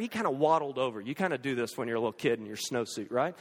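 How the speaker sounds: ordinary delivery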